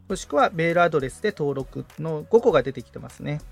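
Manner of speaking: ordinary talk, nothing marked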